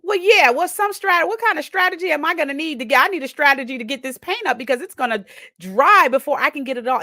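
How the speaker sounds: scrambling aloud for a strategy; frantic thinking. Retold or imitated. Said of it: "So I'm thinking".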